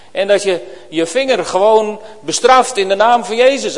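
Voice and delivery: full-voiced pulpit appeal; fiery preaching. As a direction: build the challenge to the congregation. Preaching builds to full force.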